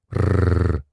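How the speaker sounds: wolf growl. Acted. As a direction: growl like a wolf baring teeth. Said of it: "волчица оскалилась".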